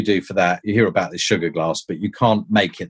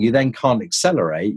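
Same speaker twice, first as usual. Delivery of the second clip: ordinary talk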